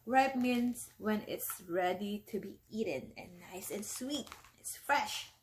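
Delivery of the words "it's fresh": usual speaking voice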